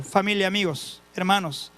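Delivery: ordinary talk, nothing marked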